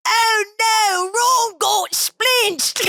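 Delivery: wailing, very high and drawn out; over-the-top mock-tragic cry, stretched slowly; Hermione impression. Guest sending up the meme line, hammed-up Hermione wail. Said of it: "I'm just being an asshole now".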